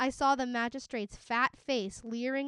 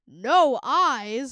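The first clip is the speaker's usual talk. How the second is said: booming; 13 dB louder than usual. A narrator's reading. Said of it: "the magistrate boomed".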